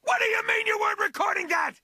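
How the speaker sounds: indignant outburst. Acted. Fighter yelling at the player, fast indignant shout. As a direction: rattle it off in outrage.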